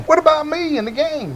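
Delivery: high-pitched mock complaint; squeaky pretend outrage; playful high protest. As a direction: plead in a comically high voice. Co-host playfully protests being left out.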